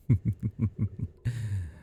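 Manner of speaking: a falling breathy chuckle; unsettling little laugh; creepy chuckle. Acted. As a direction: chuckle to yourself. Stalker amused by own words.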